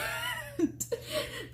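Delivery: high, soft burst of laughter; real laugh. Real, not acted. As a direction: break up laughing at your own re-enactment. Laughs at her own re-enactment.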